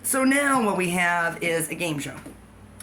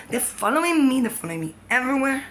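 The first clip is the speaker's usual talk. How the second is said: [name] impression; 7 semitones higher, 4 dB louder than usual. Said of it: "that's my [name]".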